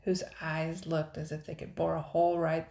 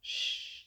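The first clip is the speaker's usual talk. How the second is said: cautioning shush. A narrator's reading. Jasper cautions the boy near sleeping pirates, cautioning shush. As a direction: hush someone with a drawn-out shh. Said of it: "Jasper cautioned".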